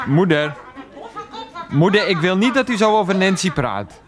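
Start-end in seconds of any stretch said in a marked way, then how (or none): 1.7-4.0 raised-pitch exasperated protest; indignant. Acted. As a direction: argue back at your mother, pitched up. Quarrels with mother defending Nancy.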